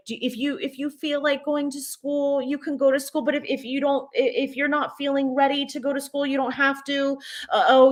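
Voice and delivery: high mock-gentle parental coaxing; mimicking permissive parents. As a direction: imitate overly gentle parents offering choices. Parents voiced in a story.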